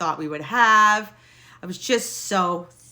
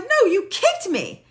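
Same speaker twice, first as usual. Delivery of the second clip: slow, higher, louder accusation; accusing retort; 13 semitones higher, 5 dB louder, slower than usual. Retold or imitated. Accusing her husband, slow, high and loud. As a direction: pin it on him. Arguing with husband after fall.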